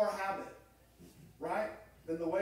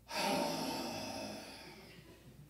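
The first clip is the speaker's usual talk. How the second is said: imitated big sigh. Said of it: "she just gives a big sigh".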